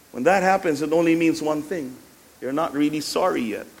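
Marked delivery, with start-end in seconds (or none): none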